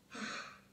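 sharp gasp of terror; terrified gasp. Acted. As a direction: gasp in sudden fright. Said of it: "Sonia cried in terror".

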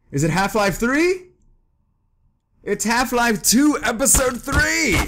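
high, slow excited shout of discovery; shouting the big reveal in delight. Real, not acted. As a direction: build to a thrilled yell. Excited discovery of game joke.

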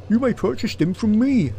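high, loud guard character; train guard. Acted. Guard selling tickets to late passengers, officious guard voice.

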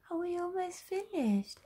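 high sing-song question. Acted. Mother repeating the question to her daughter, high and sing-song. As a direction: lift it way up like baby talk.

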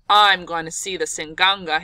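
loud, angry threat from the trader; vengeful threat. Retold or imitated. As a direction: bark it out, out for revenge. Angry trader wants revenge.

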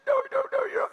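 high, sharp pleading no's; woman protesting. Retold or imitated. Woman refusing the preacher's comfort, high and pleading. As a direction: cry out no, voice way up.

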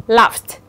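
laughed ending in f plus t. Shown as a demonstration. Teacher demonstrates the ED rule, laughed ending on t. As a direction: finish laughed with a voiceless t. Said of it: "E D đó sẽ được phát âm là âm".

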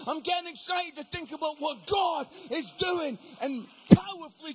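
loud, excited sermon delivery; fired-up preaching. Real, not acted. Preacher telling the group their area can change, fired-up preaching. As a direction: drive it at the congregation, hitting key words hard.

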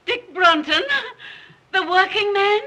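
high, loud cry of shock; appalled, voice shooting up in disbelief; aghast. Acted. Mother hearing whom her daughter will marry, aghast.

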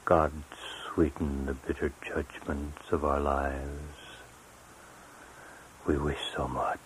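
very soft, low poem ending; hushed plea. Acted. Poet closing a poem with a plea to God, hushed. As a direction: let the prayer sink almost to a whisper.

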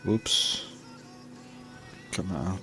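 ordinary delivery

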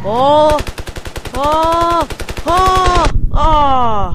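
rising panicked yelps; excited shouts. Real, not acted. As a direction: yell in rapid alarm. Near miss in firefight.

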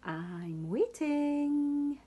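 slow high sing-song; sing-song waiting. Acted. Sam waits for goodnight.

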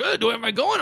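put-on high, confused old man voice; a lost old man unsure if he's on. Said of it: "The old man's like".

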